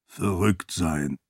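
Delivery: low, slow and soft; Ashe's dry reply. A narrator's reading. The seon Ashe completes Sarene's thought, low and calm. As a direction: finish her sentence calmly and dryly. Ashe finishes her sentence.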